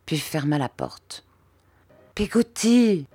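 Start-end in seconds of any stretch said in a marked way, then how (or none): 2.1-3.1 higher, alarmed call; child's anxious exclamation; frightened. A narrator's reading. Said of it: "dis-je tout effrayée".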